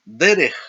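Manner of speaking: "Derech", accent thrown on the first syllable; first-syllable stress. Shown as a demonstration. Teacher listing two-segol nouns for learners, first-syllable stress on Derech. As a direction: hit the "De" of "Derech" loudly. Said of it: "en la primera sílaba".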